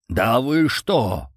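slow, stretched-out protest; indignant disbelief. A narrator's reading. Йован accused by his friends, indignant disbelief. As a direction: drag it out in shocked protest.

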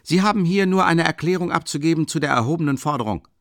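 loud, high curt order; lieutenant angrily ordering a statement; angry official order. A narrator's reading. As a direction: lay down the rule. Lieutenant still yelling.

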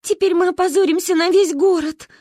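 high, loud crying lament; tearful wail. A narrator's reading. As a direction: sob out the shame. Kristina crying. (рыдающий крик)

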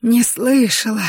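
slow wheeze, barely any air; wheezing. A narrator's reading. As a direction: gasp the words out weakly. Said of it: "просипела она".

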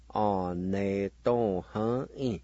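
"or, ne, to, huh, e" in linked but distinct syllables; syllable-by-syllable pronunciation. Shown as a demonstration. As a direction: step through "or, ne, to, huh, e" with little pause. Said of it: "now pronounce these words".

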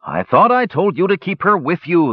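high, exasperated parental scolding. A narrator's reading. Chief to the older boy left minding her, scolding. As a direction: chide the child, pitched well up.

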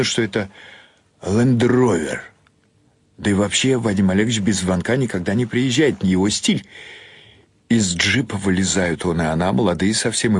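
plain reading voice

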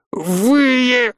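high, choked single word; strangled promise. A narrator's reading. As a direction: squeeze it out with no air. Throttled major forces out word.

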